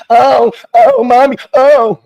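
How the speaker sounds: high, loud mock moaning of mommy; sneering parody of her moaning; mocking moans. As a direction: ham up breathless moans in a squeaky voice. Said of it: "Were you saying that when you were".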